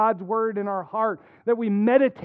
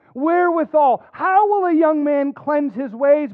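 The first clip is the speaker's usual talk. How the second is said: ordinary delivery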